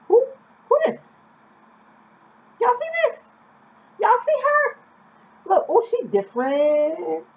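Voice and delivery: very high, gasping mock voice of staring strangers; gawking onlookers. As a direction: squeal it like a shocked gossip. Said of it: "They're like".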